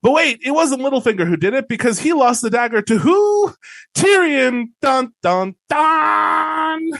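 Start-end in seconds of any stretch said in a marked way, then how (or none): 3.9-6.9 mock-suspense "dun, dun, dun", last one high and held; big hammy reveal, climbing on the final note; dramatic reveal sting. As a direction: announce the twist like a soap cliffhanger.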